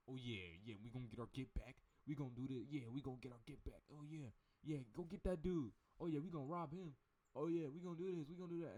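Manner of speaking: loud, high, pumped-up gang voice; mock gangster hype, shouting each yeah; hyped-up street bravado. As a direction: get yourself fired up to rob someone. Hyped robbers acted out.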